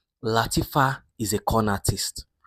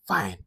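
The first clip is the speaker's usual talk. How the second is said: resigned sigh; 3 dB quieter than usual. Acted. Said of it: "the mother was like".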